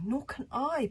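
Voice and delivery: high, panted reply; panting. A narrator's reading. Kamikaze answering exhausted Fishlegs, high panting. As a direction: pant it out in agreement. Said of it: "panted Kamikaze".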